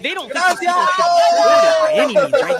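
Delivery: loud, high sarcastic jab. Retold or imitated. Vlogger mocks liberal views, loud high sarcasm. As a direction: push the irony loudly.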